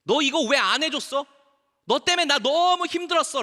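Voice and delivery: high, loud acted grievance; resentful blaming. Someone blaming another for their hardship, resentful. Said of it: "라고 원망한다고 해서".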